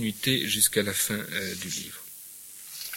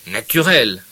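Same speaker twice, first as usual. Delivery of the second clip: sneering; 9 dB louder, slower than usual. Acted. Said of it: "ajouta Pécuchet en ricanant".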